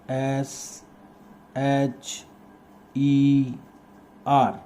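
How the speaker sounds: letter by letter, drawn out with long pauses; slow dictation. Shown as a demonstration. Teacher spelling kingfisher for pupils copying it, slow dictation. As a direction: spell it out slowly at writing speed.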